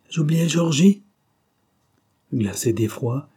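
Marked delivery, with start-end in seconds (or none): 0.1-1.0 mocking, higher and louder; sneering taunt. A narrator's reading. Ossip taunts the girl with a cruel sneer. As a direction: jeer as if remembering on purpose. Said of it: "Il ricana terrible".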